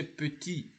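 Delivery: "petit" ending on the vowel, its last T silent; silent final T. Shown as a demonstration. Teacher showing learners the word in isolation, silent T. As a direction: say "petit" without sounding the final T. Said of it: "the last T, which is a consonant, is not pronounced".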